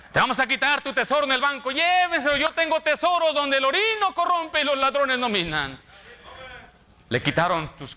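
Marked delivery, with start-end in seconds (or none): 0.1-1.7 high, fast preacher's taunt; voiced persecutor, pitched high and urgent; acted threat. 1.7-5.8 high, impassioned preacher's cry; acted believer triumphantly answering persecutors; defiant exclamation. Acted believer defies those seizing goods.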